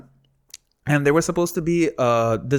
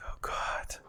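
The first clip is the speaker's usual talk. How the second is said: a hopeless aside under the breath; despairing whisper; 19 dB quieter than usual. Retold or imitated. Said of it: "And I'm just like".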